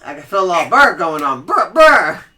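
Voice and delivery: loud, high, fast playful shiver; hammed-up brrr.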